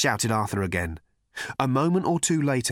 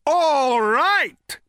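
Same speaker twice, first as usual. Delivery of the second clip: loud, high roar of rage; enraged bellow; 7 dB louder than usual. A narrator's reading. Thor the thunder god accepts Arthur's challenge, bellowing in rage. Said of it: "bellowed Thor, like an enraged bull".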